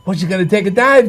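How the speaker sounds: high, laughing mock jab; Lasorda teasing. Lasorda ribbing Lou on the way to the ring, laughing. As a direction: toss it over with a laugh. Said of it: "he's hollering over to me".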